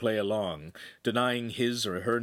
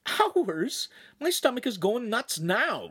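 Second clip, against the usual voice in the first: put-on high-pitched whine of a hungry kid; indignant hungry protest; complaining; 13 semitones higher, slower than usual. A narrator's reading. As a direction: complain in a raised, put-upon voice. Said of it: "Lincoln complained".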